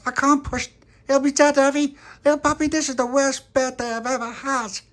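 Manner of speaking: very high, loud wail; distraught child Tony. Acted. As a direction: wail it at the top of a little boy's voice. Tony wails at little Pappy.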